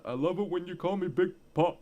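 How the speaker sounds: quoting the rap line in character. Retold or imitated. Friends chatting, mimicking [name]'s line.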